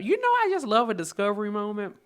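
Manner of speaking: quick and high, thrilled; high excited squeal. Real, not acted. Telling viewers about a new food, excited. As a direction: burst out delighted, high and fast.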